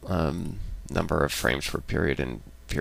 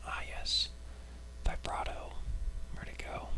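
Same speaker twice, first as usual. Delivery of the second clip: whispering; 14 dB quieter than usual. Real, not acted. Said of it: "vibrato is what I had".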